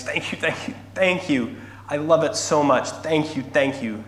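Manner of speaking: put-on high, gushing little girl's thanks; overwhelmed gratitude. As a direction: pour out repeated thanks, acting overjoyed. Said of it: "the girl's eyes well up".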